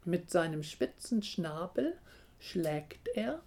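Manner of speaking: usual speaking voice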